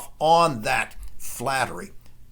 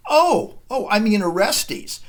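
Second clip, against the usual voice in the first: high, loud "Oh, oh" as if just catching the slip; mock sudden realization; 6 semitones higher, 4 dB louder than usual. Clytemnestra reassures the startled Agamemnon, high mock surprise. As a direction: play the queen's feigned, bright correction. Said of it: "she wants him to feel a moment of guilty panic before then saying".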